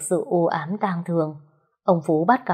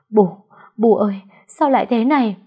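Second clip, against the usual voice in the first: tearful cry; 4 semitones higher, 5 dB louder than usual. Acted. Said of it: "nước mắt đầm đìa".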